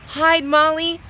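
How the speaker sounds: high, loud desperate shout; panicked shout. A narrator's reading. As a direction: scream the danger at her. Guest begs Molly to hide.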